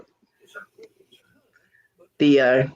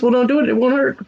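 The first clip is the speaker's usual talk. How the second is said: higher-pitched singsong comeback; teasing retort; 9 semitones higher, faster than usual. Retold or imitated. John answering hurt kids with his stock line, teasing. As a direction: toss it back as a mock scolding.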